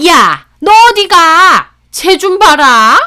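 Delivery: loud, high, drawn-out shout; angry call-out. Acted. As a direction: shout after her, outraged. Mother-in-law shouts after her.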